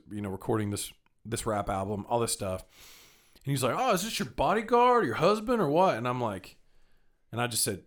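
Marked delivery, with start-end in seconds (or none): 3.8-5.9 much higher mock voice; imitated hustler teasing. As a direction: tease the big guy beside her. Street seller teasing.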